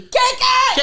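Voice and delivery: loud, high cheer; shout. Real, not acted. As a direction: shout it like a hype intro. Shouted catchphrase.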